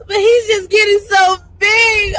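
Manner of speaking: loud, high-pitched emotional wail; gushing. Real, not acted. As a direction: cry it out, overwhelmed and proud. Mother gushing over her growing son.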